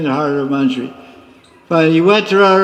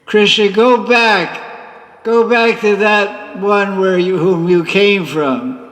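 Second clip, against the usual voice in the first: Radharani sending Krishna away; 4 semitones higher, slower than usual. Acted. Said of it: "Radharani tells Krishna at this juncture".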